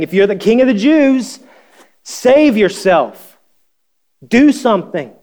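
high, loud sneering taunt; soldiers' mocking taunt. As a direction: jeer it up at him.

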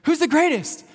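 high, loud, quick demand; insistent disciples. Retold or imitated. Disciples boasting of their deeds press Jesus, insistent. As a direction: imitate disciples pressing hard for the answer.

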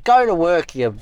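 high, loud bossy wake-up call; bossy alarm voice. Retold or imitated. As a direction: bark it like a nagging alarm. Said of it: "that was the alarm saying".

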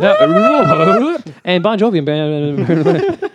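high squeal then slow-motion words; rewind effect. Pretend. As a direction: play it like a tape rewinding. Mimicking a rewinding tape.

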